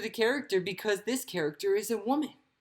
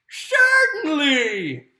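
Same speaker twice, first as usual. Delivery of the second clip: high, loud, drawn-out exclamation; big, high kangaroo cry; loud character call; 7 semitones higher, 10 dB louder, much slower than usual. Acted. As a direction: sing it out loud and long. Voices kangaroo in story reading.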